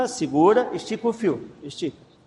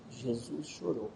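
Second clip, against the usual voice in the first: low, hushed and slow; quiet prompt; 4 semitones lower, 13 dB quieter, slower than usual. Shown as a demonstration. Giving Pérola a verse tip to repeat, quiet prompt. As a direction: slip the hint softly, drawn out. Whispers the hint verse to child.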